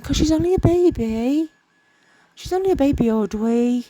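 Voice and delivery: put-on high, loud silly baby-talk voice; daft voice. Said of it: "I don't know why I'm doing that daft voice".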